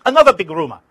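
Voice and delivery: loud, scornful shout. Retold or imitated. Arafat shouting down the reporter's question. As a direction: throw it back at him, loud. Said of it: "Arafat was shouting".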